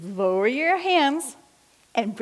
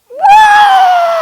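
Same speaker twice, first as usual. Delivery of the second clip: a huge, high lion roar shouted out; 15 dB louder than usual. Pretend. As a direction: roar it full force like a lion cub. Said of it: "as we roar like a lion".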